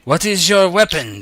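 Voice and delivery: commanding arena master. Acted. Game master voices master asking again.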